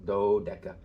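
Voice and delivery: "dodeca" with the accent on the opening DO; stress on first syllable. Shown as a demonstration. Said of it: "Here the accent is on this first syllable".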